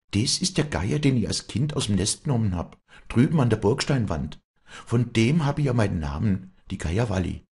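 a hint of proud showing-off; proud young Walli.

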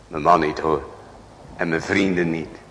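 ordinary talk

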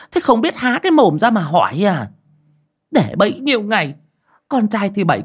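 angry scolding. A narrator's reading. Aunt berating the foolish couple, angry scolding. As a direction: tear into them like a furious elder. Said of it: "mà mắng".

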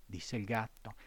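ordinary delivery, nothing marked

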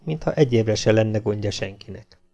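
ordinary delivery, nothing marked

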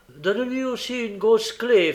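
high, loud interjection; gruff accomplice chiming in. A narrator's reading. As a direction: chime in loudly with an order. Sikes calls out a suggestion.

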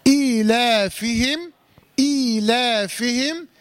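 إيلافهم recited slowly, opening "ee" and "laa" evenly lengthened; two-count madd. Shown as a demonstration. Teacher showing the two-count lengthening, slow measured recitation. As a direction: draw out the long vowels of إيلافهم in measure. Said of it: "ومقدار المد البدل اتفقنا كم حركة؟".

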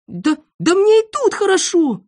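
very high, agitated little character voice; panicky refusal. A narrator's reading. Boy under bench refuses to jump, high and panicky. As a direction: protest high and urgently.

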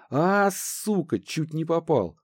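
high, slow startled cry; startled exclamation. Acted. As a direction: blurt it out, nearly hit. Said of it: "воскликнул один из бойцов".

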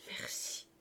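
near-whispered, very soft; barely voiced, overcome; hushed thanks. A narrator's reading. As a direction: breathe out one grateful word. Overcome old man thanks Marius.

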